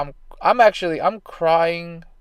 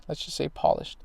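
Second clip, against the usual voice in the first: whispered aside; 8 dB quieter than usual. Real, not acted. Muttered self-correcting aside.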